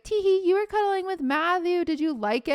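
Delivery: high teasing giggly voice; lightly voiced taunting friends; mocking girly friends. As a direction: tease like a smug schoolgirl. Friends teasing her at school.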